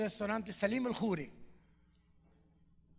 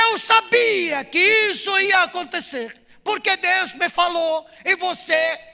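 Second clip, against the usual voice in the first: tearful outburst; 8 semitones higher, 14 dB louder than usual. Retold or imitated. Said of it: "Gente, começou a chorar esse".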